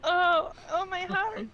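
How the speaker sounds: having a heart attack. Acted. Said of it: "Glimmer fakes a heart attack".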